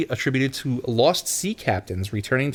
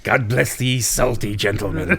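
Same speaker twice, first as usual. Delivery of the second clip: put-on old salty sailor toast; lightly voiced old sea dog; sea captain voice; 3 semitones lower than usual. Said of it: "a different sea captain voice".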